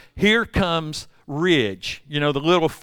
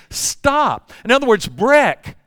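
firm scolding; 6 semitones higher, 5 dB louder than usual. Retold or imitated. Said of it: "He's saying".

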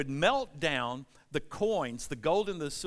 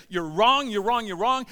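calling out you're wrong, louder each time; loud accusing cry; 5 semitones higher, 8 dB louder than usual. Acted. Prophet shouting wrong at the people in the street, loud cry.

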